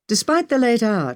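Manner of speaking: plain reading voice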